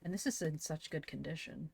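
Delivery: hushed whisper. Real, not acted. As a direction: whisper it softly. Whispered aside while reading books.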